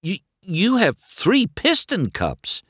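slow, high-pitched amazed stammer; astonished, voice way up high. A narrator's reading. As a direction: stumble on the first word in awe. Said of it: "McQueen stammered".

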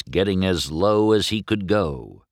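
ordinary delivery, nothing marked

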